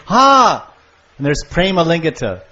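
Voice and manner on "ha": sharp high delighted gasp, louder; a lover's burst of joy on meeting; joyful gasp. Retold or imitated. A lover reaching the beloved gives a high joyful gasp. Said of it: "they go".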